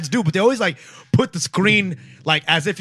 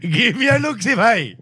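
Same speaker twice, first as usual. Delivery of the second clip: mock Indian accent; slower than usual. Teasing friend to show sister.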